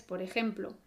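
ordinary talk, nothing marked

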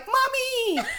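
put-on very high child's squeal; mimicked daughter. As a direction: call out like an overexcited little daughter. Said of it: "her daughter's on the side going".

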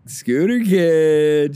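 drawn-out loud hype call; hyped shout out. Real, not acted. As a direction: call the name out slow and loud. Shout out to Scooter Kid.

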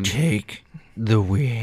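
deep slow growl. Acted. Martha insisting.

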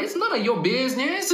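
imitated high-pitched comeback; indignant retort. Mom answering a nosy stranger in the supermarket, high indignant retort.

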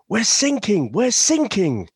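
high-pitched mock panic cry; British distress caller. British sailor radioing the German coastguard, mock distress. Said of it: "And then he goes".

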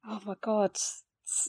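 ordinary delivery

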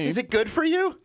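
high, loud mocking voice. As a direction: sneer it in a high voice. Mocking pig eater.

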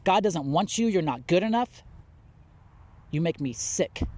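harsh, contemptuous get out of here; mock rejection. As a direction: spit it out like a self-righteous church member. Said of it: "and God's people are like".

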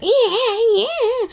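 high mock baby sob; imitated baby sobbing. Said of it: "So it was just sobbing, like".

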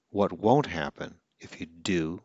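normal talking voice

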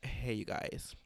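whispered voicemail greeting, imitating a caller; hushed imitation. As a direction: open the mock message in a near-whisper. Retold hostile voicemail opening.

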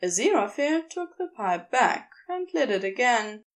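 ordinary delivery

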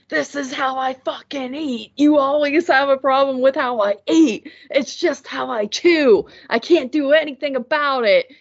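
high, furious imitated yell; flipped out yelling. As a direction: mimic him snapping back at you in the car. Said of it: "he just, like, flipped".

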